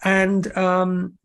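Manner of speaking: normal talking voice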